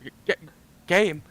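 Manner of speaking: high, loud, stuttering fright; stammering in shock. Pretend. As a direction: stammer it out, terrified. Player character stammering in shock.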